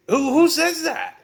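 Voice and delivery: high, loud exasperated question; incredulous. Real, not acted. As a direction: voice jumping up in sheer disbelief. Mocks the book's wording.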